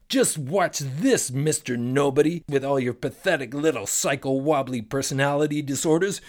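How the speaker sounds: seethe like an insulted genie; angry genie. Acted.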